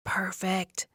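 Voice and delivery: voiceless whispered word; mouthed silently. Acted. Debbie mouths to the narrator across the table, a voiceless whisper. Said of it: "she mouths".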